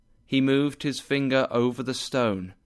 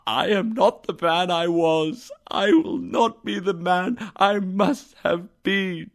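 earnest pleading; 7 semitones higher than usual. A narrator's reading. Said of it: "he cried".

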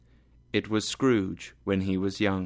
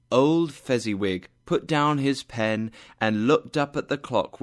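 plain reading voice

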